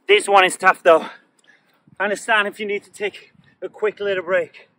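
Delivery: out of breath, loud and strained; panting through the words after exercise; after a workout. Real, not acted. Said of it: "My legs are burning".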